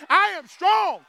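high, loud declaration; with conviction. Shown as a demonstration. Preacher showing the congregation how to say it, with conviction. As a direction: belt it out like you believe it. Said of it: "say it like you believe it".

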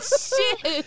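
high, drawn-out expletive; retold shocked curse. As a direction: blurt it in mock alarm. Retells sobering up in shock.

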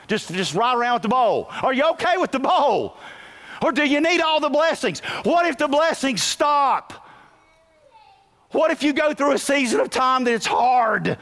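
climbing pulpit shout; full-force preaching. Acted. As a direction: press the church with urgent questions.

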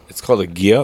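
normal talking voice

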